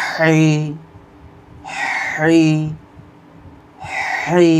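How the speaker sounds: হাই pressed from the middle of the throat; throaty hi. Shown as a demonstration. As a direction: squeeze হাই out of the mid-throat. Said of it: "কণ্ঠনালীর মাঝখানে চাপ দিয়ে উচ্চারণ করুন".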